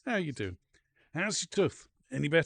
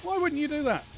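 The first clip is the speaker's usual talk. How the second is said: mock indignation; 13 semitones higher, 5 dB louder than usual. Mock hurt at characters.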